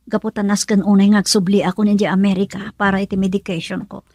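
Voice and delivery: ordinary delivery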